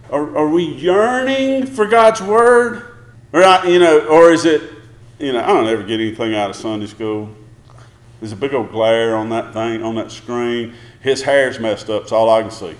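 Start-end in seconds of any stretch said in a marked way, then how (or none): 5.2-12.7 mock grumble. Mocking a bored churchgoer.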